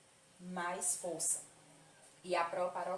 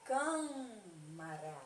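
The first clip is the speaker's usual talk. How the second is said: Câmara very slowly, with a long-held "Câ"; stress on first syllable; much slower than usual. Shown as a demonstration. Teacher quizzing pupils on proparoxytones, stress on the "Câ" of Câmara. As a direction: stretch the start of Câmara, then let it fall. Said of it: "Qual foi".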